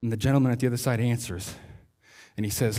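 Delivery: normal talking voice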